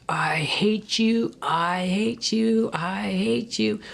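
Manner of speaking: high, slow, anguished I hate you; retold anguished cry. Pounding the grass, crying out at God. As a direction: stretch each word like a wounded cry.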